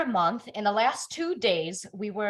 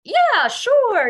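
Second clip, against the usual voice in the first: husband's reply; 14 semitones higher, 9 dB louder than usual. Retold or imitated. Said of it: "he's like".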